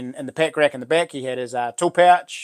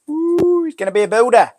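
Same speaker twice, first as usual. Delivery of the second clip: excited cheer; 9 semitones higher, 7 dB louder than usual. Real, not acted. Cheering the son's new job.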